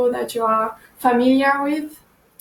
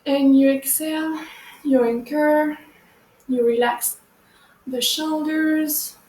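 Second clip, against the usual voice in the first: guided meditation. Acted. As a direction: ease them into breathing gently. Leads a meditation.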